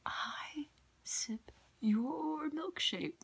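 high, slow whispered villain line; movie villain quote. Retold or imitated. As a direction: whisper "milkshake" drawn out, gloating. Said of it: "the movie There Will Be Blood".

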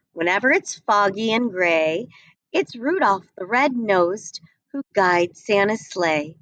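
plain reading voice

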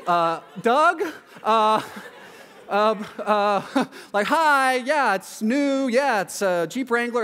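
high, nervous politeness with halting starts; trying to be polite. Acted. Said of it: "he's trying to be polite".

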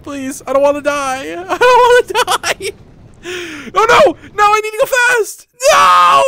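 high desperate wail building to shouts; panicked screaming. Real, not acted. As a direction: plead for your life, loudly. Panicking as game vehicle speeds up.

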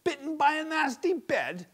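high, confused half-asleep husband; groggy husband. A narrator's reading. Half-asleep husband mishearing his wife, groggy and confused. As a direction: mishears her through sleep. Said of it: "half asleep".

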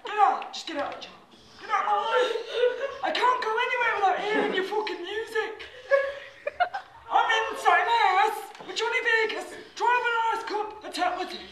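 hammed-up Scottish rant; exasperated Scottish accent. Comic tells Justin to get out of his life, exasperated Scottish accent. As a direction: complain bitterly in a put-on Scottish accent. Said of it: "I'm doing Scottish accent".

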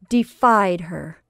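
ordinary delivery

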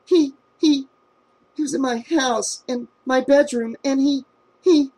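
high, broken, fearful stammering; can't get the words out for fear; terrified girl telling her neighbour about the attacker; panicked stammer.

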